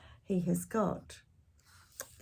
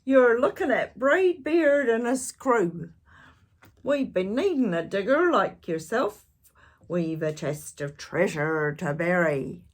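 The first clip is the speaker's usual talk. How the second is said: hearty pirate boast; pirate captain; 6 dB louder than usual. Acted. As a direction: introduce your crew like a proud captain. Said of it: "he said".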